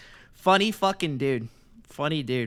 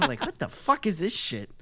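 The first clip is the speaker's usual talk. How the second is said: ordinary delivery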